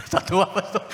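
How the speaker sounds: high, laughing through the words. Real, not acted. Pastor laughing as he retells the widow story.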